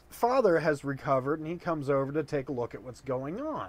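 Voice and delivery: normal talking voice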